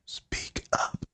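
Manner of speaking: whispered mock plea; super quiet. Coach telling a too-quiet student, in a mock whisper. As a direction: urge them on in a voiceless whisper. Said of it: "like, super quiet".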